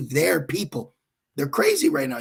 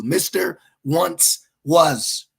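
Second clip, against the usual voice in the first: Mister Once Was, slowly, word by word; emphatic mocking title; 2 semitones higher, 3 dB louder, much slower than usual. Real, not acted. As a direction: pause between each word of the title.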